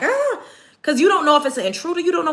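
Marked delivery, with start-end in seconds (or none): 0.0-0.4 very high mock scream; short startled child's shriek; imitated kids' scream. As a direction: squeal like a frightened child. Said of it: "screaming, like".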